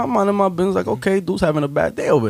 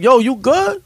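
mock challenge; 8 semitones higher, slower than usual. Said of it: "I said in Spanish".

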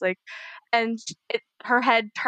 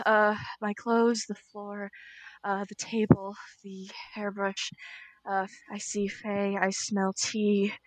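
shaky grounding; 3 semitones lower, 8 dB quieter than usual. Acted. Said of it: "running through this anxiety thing".

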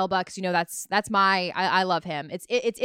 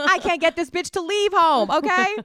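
mock exasperated; 9 semitones higher, 6 dB louder than usual. Mock partner complaining about boyfriend.

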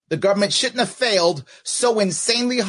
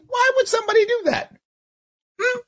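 incredulous shout. Real, not acted. Heckling the speaker with a high disbelieving shout.

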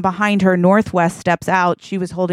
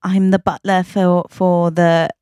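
slow, halting British butler; British butler accent; slower than usual. Acted. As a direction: introduce yourself as a hesitant British butler. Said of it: "he's got a British accent".

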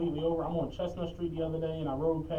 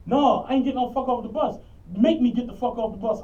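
mock defiant man; 5 semitones higher, 6 dB louder than usual. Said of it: "He like".

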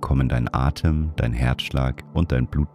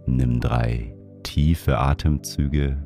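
guided meditation; 3 dB quieter than usual. Acted. Leads a breathing meditation.

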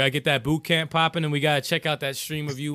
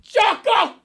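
loud high hype shout from a distance; yelling from across the room; 6 dB louder than usual. Real, not acted. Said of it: "stop peeking the mic".

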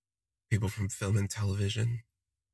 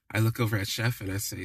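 ordinary delivery

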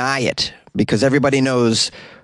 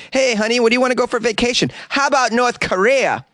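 mock spouse; 12 semitones higher than usual. Mock couple planning vacation.

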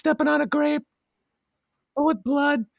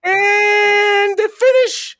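race announcer; 3 semitones higher than usual. Pretend. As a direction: stretch the first word like a track announcer. Calls an imaginary car race.

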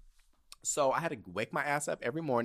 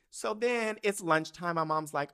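ordinary talk, nothing marked

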